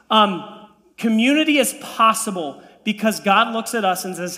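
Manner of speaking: usual speaking voice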